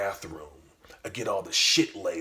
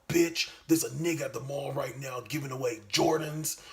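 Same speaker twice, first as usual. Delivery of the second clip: higher, urgent excitement; excited demand; 7 semitones higher than usual. Retold or imitated. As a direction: jump at the news of free shoes. Excited about free Jordans.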